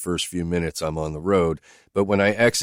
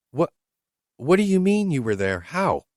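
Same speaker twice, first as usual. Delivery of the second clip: higher, lightly voiced woman asking bluntly; higher woman's voice, questioning her driver; Whitney's voice; 11 semitones higher, 3 dB louder than usual. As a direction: lift into her voice, direct and searching.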